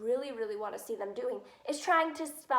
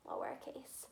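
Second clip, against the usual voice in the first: soft unvoiced whisper; 11 dB quieter than usual. Real, not acted. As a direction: whisper it as an aside. Teacher aside to class.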